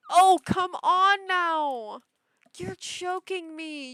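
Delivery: loud, high, drawn-out exclamation; big, slow, incredulous outburst; gushing disbelief. Real, not acted. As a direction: exclaim as if it's too cute to believe. Exclaiming over cute items.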